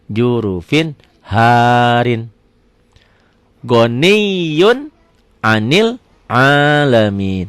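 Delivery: drawn-out, chanted Arabic reading; measured tajwid recitation; slow Quran recitation. Shown as a demonstration. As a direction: recite it slowly, stretching each vowel. Teacher recites Quranic phrase.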